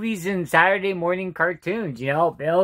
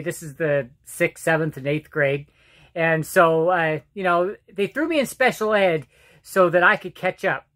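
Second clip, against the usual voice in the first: usual speaking voice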